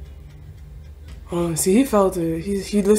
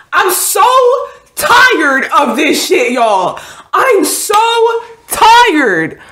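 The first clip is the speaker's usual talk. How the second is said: high, loud wail of protest; real upset outburst; 11 semitones higher, 6 dB louder, slower than usual. Real, not acted. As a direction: cry out, fed up and drawing words out. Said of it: "I'm not happy".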